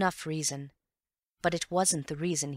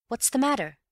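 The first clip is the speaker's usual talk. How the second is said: sharp, louder and higher question; a sharp, raised demand; sharply; 8 semitones higher, 5 dB louder than usual. Acted. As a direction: snap the question out at him. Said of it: "said Bill sharply".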